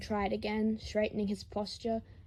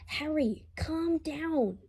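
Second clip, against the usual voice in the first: urging calm; 7 semitones higher, slower than usual. Acted. Boy restraining Harry.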